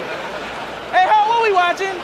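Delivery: high call across the room. Acted. Witness calling to his partner while telling the story.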